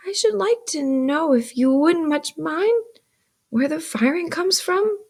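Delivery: put-on high, careful questioning boy; polite child. A narrator's reading. Pip asks his sister as last resource, politely.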